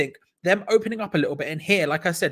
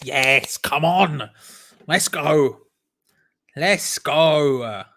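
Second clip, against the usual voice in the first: loud, triumphant cheer; full-voiced fan celebration; celebratory shout; 2 semitones lower, 5 dB louder, much slower than usual. Real, not acted. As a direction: cheer it out loudly. Celebrating the goal, louder second yes.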